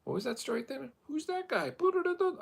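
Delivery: very high, put-on voice; mock high voice. Asking his past self about the story in a very high put-on voice. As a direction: squeak it to your past self.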